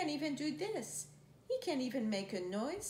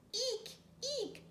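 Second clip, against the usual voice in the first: high thin squeak; squeaky shoe eek; 11 semitones higher, 3 dB quieter than usual. Shown as a demonstration. Reading to a child, squeaking like a shoe. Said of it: "like a squeaky shoe".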